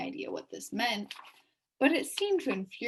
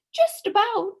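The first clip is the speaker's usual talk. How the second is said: a hint of pride; 13 semitones higher, 10 dB louder than usual. A narrator's reading. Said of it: "a hint of pride in his voice".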